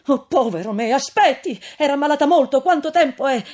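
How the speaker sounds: put-on loud, high pleading young man; frantic, pleading call up to a window; desperate plea. A narrator's reading. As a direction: beg her not to shut the door. Renzo begs for news.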